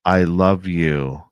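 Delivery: slow, flat and lifeless pleading; imitating the non-human voice, mild monotone; emotionless voice. Eerie voice luring them from the trees, lightly voiced, no emotion. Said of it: "there's no emotion".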